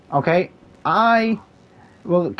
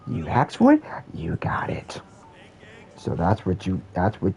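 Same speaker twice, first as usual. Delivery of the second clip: whisper; 10 semitones lower, 7 dB quieter than usual. Acted.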